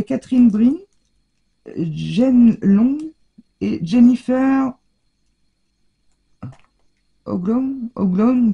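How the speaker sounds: English names in a French accent, hesitantly sounded out; French speaker's tentative English accent; attempted English accent. Real, not acted. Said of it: "avec mon accent anglais".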